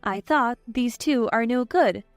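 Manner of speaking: ordinary delivery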